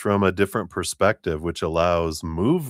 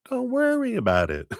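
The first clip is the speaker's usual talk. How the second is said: laughing; 13 semitones higher than usual. Retold or imitated. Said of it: "so it's like".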